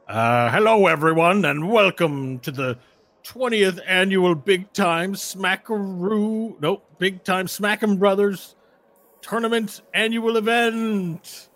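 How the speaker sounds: loud announcer voice stumbling over the event name; intercom announcer. Acted. GM voicing the announcer to the players, hammed-up tournament host. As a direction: boom it out like a tournament host on the speaker.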